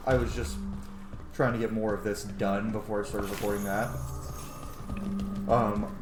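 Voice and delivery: usual speaking voice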